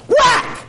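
high loud shouted whack; whack sound. Retold or imitated. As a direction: shout the bat's impact. Hitting God metaphor sound.

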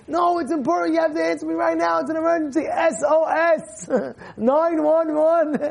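high, hammed-up pleading alarm with laughter; mock urgency. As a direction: insist playfully as if it's a crisis. Acts insistent texter faking emergency.

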